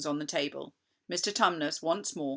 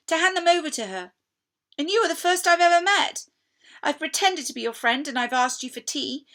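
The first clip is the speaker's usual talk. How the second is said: high, loud, pleading fawn voice; guilty fawn pouring out his shame to Lucy; anguished fawn; 12 semitones higher, 7 dB louder than usual. Acted. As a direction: beg her to see how wicked you are. Tumnus revealing the Witch's orders.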